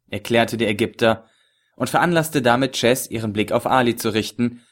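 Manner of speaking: ordinary delivery, nothing marked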